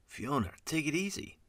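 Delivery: high-pitched calming appeal; placating plea. A narrator's reading. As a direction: plead with an angry colleague to settle down. Devlin tries to calm Fiona.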